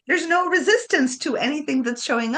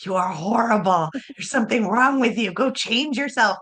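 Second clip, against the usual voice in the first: mock harsh shame; 5 semitones lower than usual. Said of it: "All of it is like".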